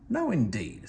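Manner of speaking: plain reading voice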